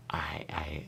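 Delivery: breathy unvoiced whisper of a thought. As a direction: whisper it to yourself.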